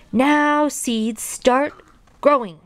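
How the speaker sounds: high, emphatic word-by-word shouted command; shouting. A narrator's reading. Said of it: "and shouted".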